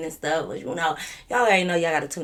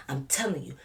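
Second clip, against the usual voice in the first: low whispered aside; imitated officer; 3 semitones lower, 6 dB quieter than usual. Officer confiding in her, imitated in a whisper. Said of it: "This what he was doing to me".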